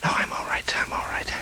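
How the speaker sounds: faint breathless whisper; weak whisper. Acted. Dying columnist reassuring the reporter in a faint whisper. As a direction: force the words out, barely there. Dying man after heart attack.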